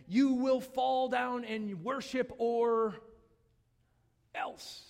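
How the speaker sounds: lightly voiced stern herald, slow and drawn out; mock royal decree, slow with breathy ending; commanding king decree. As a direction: announce the threat slowly, then drop to a hiss on else. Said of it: "and it's like, okay".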